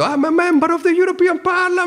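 high-pitched, strained protest cry; hammed-up yell in Italian-accented English; retold shouting. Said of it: "di me che grido".

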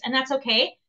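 ordinary talk, nothing marked

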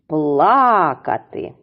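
"Плакати" with a long stressed Пла-; stress on first syllable. Shown as a demonstration. Teacher naming what the boy does, long stressed first syllable. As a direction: stretch PLA in "Плакати": PLAAA-katy. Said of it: "падає на перший склад".

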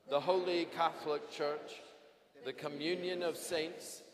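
ordinary delivery

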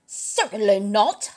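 slow, affronted huff; huffy Jack Sprat. A narrator's reading. Jack Sprat accused at his table, slow huffy denial. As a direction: deny it with puffed-up slowness. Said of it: "huffed Jack Sprat".

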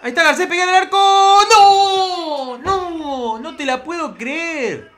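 urgent high scream into a drawn-out lament; shout turning to dismay. Real, not acted. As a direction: yell for the shot, then wail in disbelief. Watches a shot on goal missed. (grito agudo que termina en lamento incrédulo)

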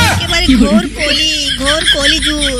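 slow, loud cry of despair at the age; loud lament. Acted. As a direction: wail it out slowly like a village elder.

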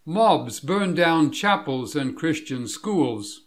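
normal talking voice

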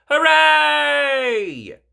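put-on high, loud children's cheer; children cheering their host, high and loud. A narrator's reading. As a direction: throw it up in delight. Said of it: "cheer Peppa and all her friends".

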